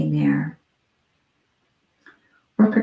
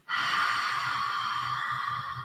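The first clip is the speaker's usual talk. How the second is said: ocean-like exhale through a constricted throat; Ujjayi breath; 10 dB quieter than usual. Shown as a demonstration. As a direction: breathe out slowly as if fogging glasses. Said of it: "making that sound".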